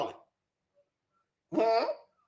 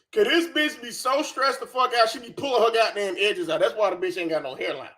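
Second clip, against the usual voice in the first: ordinary talk, nothing marked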